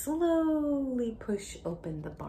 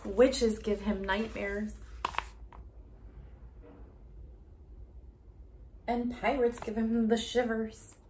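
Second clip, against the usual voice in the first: storybook reading; 3 semitones higher, 4 dB louder than usual. Acted. Teacher reading a Halloween book to a child, dramatic. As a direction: read it big and deliberate for a child. Reads story text aloud.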